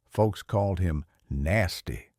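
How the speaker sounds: ordinary delivery, nothing marked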